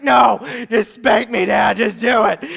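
loud, slow, acted begging; hammed-up yelled plea; desperate pleading shout. Boy begging dad again.

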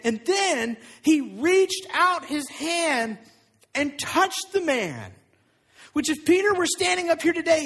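sermon at full force; impassioned preaching. Acted. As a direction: preach it with fire. Preaching with rising intensity.

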